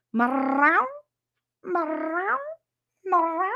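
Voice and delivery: high, sing-song meows; calling the cat. Real, not acted. Streamer calling her hiding cat with high meows.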